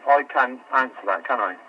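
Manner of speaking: usual speaking voice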